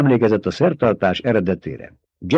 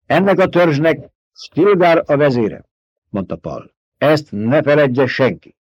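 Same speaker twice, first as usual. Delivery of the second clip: Paul proclaiming; 5 semitones higher than usual. A narrator's reading. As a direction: declare it like a lord before his people. Paul names Stilgar leader. (ünnepélyes, parancsoló hercegi hang)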